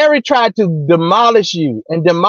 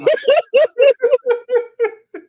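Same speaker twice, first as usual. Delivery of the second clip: breathless wheezing laugh, pitched way up; high squealing laugh. Real, not acted. Laughing helplessly after mocking William, high squeal. Cracking up at own impression.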